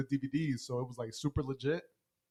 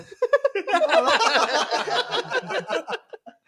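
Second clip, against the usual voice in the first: long, high, loud cackle; helpless laughter; 8 dB louder than usual. Real, not acted. Friend picturing a theater of two families, loud laughing fit.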